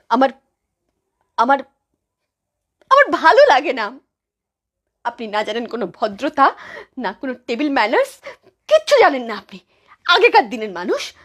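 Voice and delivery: angry scolding, pitch and volume jumping up; gniewna reprymenda. Acted. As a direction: lay into her, loud and exasperated. Daughter-in-law scolds her mother-in-law.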